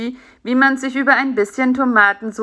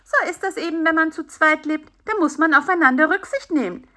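higher, matter-of-fact reproof; lightly voiced lecturing fly; 9 semitones higher than usual. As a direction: admonish lightly in a raised character voice.

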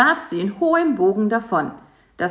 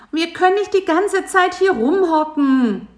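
high nagging insect voice; 11 semitones higher, 6 dB louder than usual. A narrator's reading. Bisi nagging Karl-Heinz to leave the web, high and whiny. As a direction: complain high and loud, nagging. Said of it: "nörgelte Bisi".

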